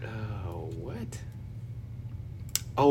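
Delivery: ordinary talk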